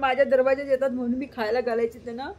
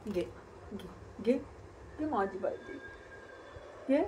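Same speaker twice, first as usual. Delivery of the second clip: soft coaxing; 3 semitones lower, 13 dB quieter than usual. Real, not acted. Coaxing child to take biscuit.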